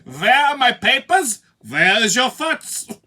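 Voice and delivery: loud, high German officer parody; mock German accent. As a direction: demand it in a hammed-up German accent. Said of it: "with a German accent".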